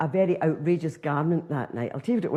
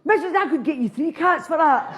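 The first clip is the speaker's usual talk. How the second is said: put-on high cheeky kid bargaining; a chancer of a wee boy, pitched high; 10 semitones higher, 3 dB louder than usual. Wee boy haggling over the reward, high and louder.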